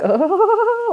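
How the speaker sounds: high, silly mock giggle; imitated wacky giggling. Acting out how wacky she seemed to her boyfriend, mock giggling. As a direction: giggle madly on a high held note. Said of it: "I was giggling for no reason".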